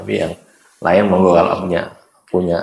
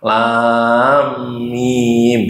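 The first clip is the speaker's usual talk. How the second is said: demo reading. Shown as a demonstration. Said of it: "kok kaya diidgham".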